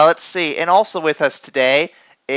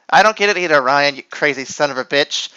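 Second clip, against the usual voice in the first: usual speaking voice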